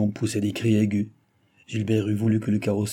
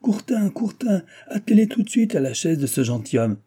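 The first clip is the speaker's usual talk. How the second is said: high-pitched hail across a yard; calling out; 8 semitones higher, 4 dB louder than usual. A narrator's reading. Postmaster orders horses harnessed for the gentleman, calling out. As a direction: call the stable hand, pitched well up. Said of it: "cria le maître de poste".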